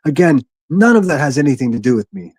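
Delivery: ordinary talk, nothing marked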